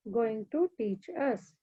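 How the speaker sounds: put-on slow, hushed prayer led for the children; class prayer. Teacher leading pupils in morning prayer, slow and hushed. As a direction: pray slowly, pausing between every phrase.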